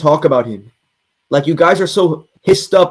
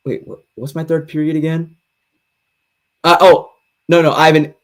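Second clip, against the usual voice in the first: ordinary talk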